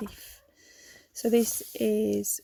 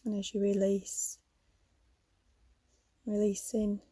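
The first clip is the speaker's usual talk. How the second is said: calm yoga nidra guidance with long pauses; guided meditation; faster than usual. Real, not acted. Leads a yoga nidra relaxation.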